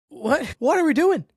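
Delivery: high, loud disbelieving cry of "What are we doing?"; incredulous outburst. Real, not acted. Reacting to the Bucks keeping Giannis, incredulous outburst. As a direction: throw up your hands in disbelief.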